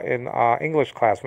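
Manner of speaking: ordinary delivery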